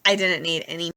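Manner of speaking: ordinary delivery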